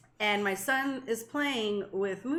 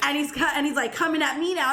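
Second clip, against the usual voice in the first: ordinary delivery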